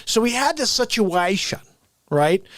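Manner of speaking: ordinary talk, nothing marked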